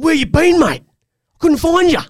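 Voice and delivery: sharp, high, loud scolding; angry at son. Retold or imitated. Father snapping at his lost son, loud and high. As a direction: snap at the boy, pitch way up and loud. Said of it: "And I was angry at him".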